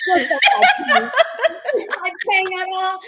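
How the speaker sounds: high, laughing through the words. Real, not acted. Laughing while retelling herself.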